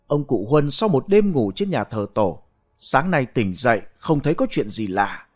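usual speaking voice

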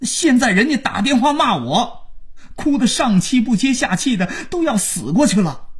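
high-pitched aggrieved rant; worked-up, accusing tirade; angry scolding. A narrator's reading. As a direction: complain bitterly down the phone. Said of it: "万征还在骂".

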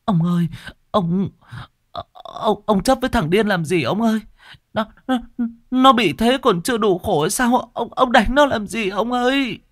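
high, tearful wailing plea; whimpering plea. Acted. Wife clinging to husband, high tearful plea. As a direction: beg him to stop, voice cracking high. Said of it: "Bà Kiểm ôm chồng mếu máo".

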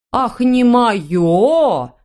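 slow, offended outburst; indignant. A narrator's reading. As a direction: drag it out, stung. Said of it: "Недобро прищурилась брюнетка".

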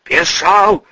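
high-pitched strangled cry; choked croak. Acted. Bodyguard shaken by the boss answers, croaking it out. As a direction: force the word out hoarsely. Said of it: "прохрипел Белый".

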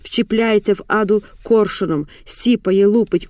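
usual speaking voice

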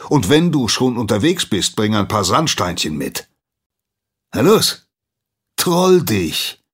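loud, rising scolding that ends in a high yell; angry dismissal. Acted. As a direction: drive the boy out of the forge. Master chases apprentice out.

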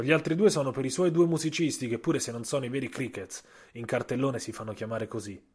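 plain reading voice

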